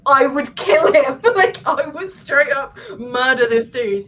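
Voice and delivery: slow, emphatic mock threat with a laugh; mock exasperation. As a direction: hammer it out in hammed-up outrage. Retelling own exasperation at slow talker.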